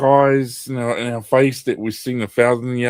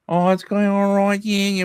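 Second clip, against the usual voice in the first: higher-pitched mock English accent; posh English accent; 10 semitones higher than usual. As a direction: put on a plummy English accent. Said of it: "We're talking bloody".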